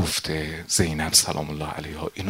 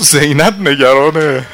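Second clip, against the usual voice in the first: زینب نگرانه cried high and loud; urgent outcry; 8 semitones higher, 14 dB louder, slower than usual. Acted. Nafe repeating the news to Habib, crying out. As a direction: push it out in anguish.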